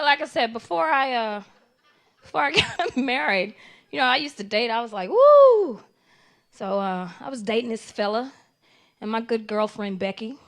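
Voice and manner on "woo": loud high whoop. Retold or imitated. Comic recalling dating days, whooping loud and high. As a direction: let out a party whoop.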